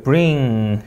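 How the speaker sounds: "bring" with an English r straight after b; "bring", tongue not touching for the r; R sound. Shown as a demonstration. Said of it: "받침 없는 리을".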